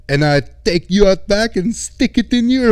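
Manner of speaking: high, loud hammed-up Russian threat; over-the-top fake Russian menace; mock Russian accent. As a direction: ham up a bad Russian accent. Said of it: "He's like".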